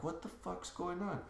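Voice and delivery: very soft mumble under the breath; retold sleepy confusion. Waking to the door opening, quietly confused. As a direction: barely voice it, as if just woken.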